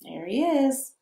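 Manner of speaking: ordinary delivery, nothing marked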